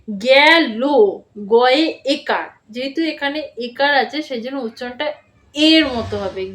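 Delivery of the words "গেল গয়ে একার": spelling out. Shown as a demonstration. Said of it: "আমি কিভাবে বলছি".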